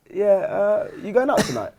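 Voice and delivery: high, hesitant imitated girlfriend; halting question with the voice lifted; a bit weird. Girlfriend asks about tonight, sounding a bit weird. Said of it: "like, a bit weird".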